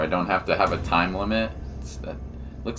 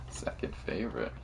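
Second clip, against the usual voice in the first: "Second favorite." breathed through a laugh, unvoiced; laughing whisper; 11 dB quieter than usual. Real, not acted.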